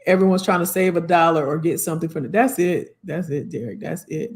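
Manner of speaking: normal talking voice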